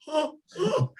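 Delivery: high-pitched "oh" of a burnt mouth; hammed-up yelps through a scalded mouth; mock pained cries. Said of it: "And I'm going".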